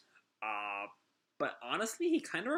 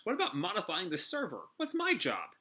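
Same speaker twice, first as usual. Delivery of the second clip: snapping; 7 semitones higher than usual. Acted. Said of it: "he finally snaps".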